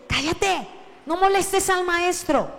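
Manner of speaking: high-pitched acted telling-off; scolding. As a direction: scold someone for pestering the teacher. Crowd scolding the blind beggar.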